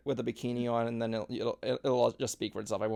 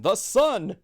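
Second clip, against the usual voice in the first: shouted high and loud; excited shout; 8 semitones higher, 9 dB louder than usual. Real, not acted. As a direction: blurt it out at full volume. Answering vacation question, yelled.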